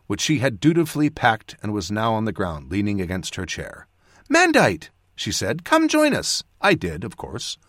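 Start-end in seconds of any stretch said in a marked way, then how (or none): 4.3-4.9 put-on high, louder girlish call; young companion calling. A narrator's reading. Jas calls Mandite over to the table, high-pitched call. Girl calls narrator to join. 5.6-6.4 put-on high-pitched girlish invitation; young companion inviting. A narrator's reading. Jas invites the mage to join them, high voice. Girl invites narrator over.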